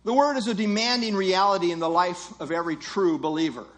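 ordinary talk

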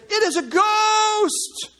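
high, loud, drawn-out cry of fear; terrified cry. Acted. Preacher voicing frightened disciples at sea, terrified cry. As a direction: cry out in alarm, stretching the words. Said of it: "they cried out for fear".

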